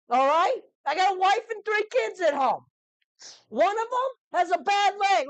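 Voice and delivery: high, loud, frantic rant; a squeaky hired guard shouting that he quits; panicked merchant guard. Acted. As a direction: plead your way out at the top of your voice.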